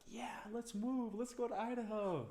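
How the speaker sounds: imitating eager little girls; excited little girls. Daughters begging dad to move to Idaho, high excited kid voice.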